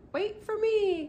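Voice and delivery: high, loud plea to wait; a little girl calling after the others. Acted. Small sister calls out to the big children, high and loud. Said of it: "cries Isabel".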